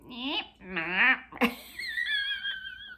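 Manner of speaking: a tiny squeaky cartoon laugh, very high; high squeaky funny-voice laugh; squeaky silly-voice laugh. Shown as a demonstration. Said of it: "trying out funny voices".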